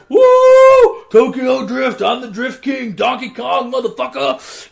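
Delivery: very high, loud hammed-up whoop; mock hype shout. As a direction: hype it like a cheesy street racer. Mocking the Tokyo Drift hype.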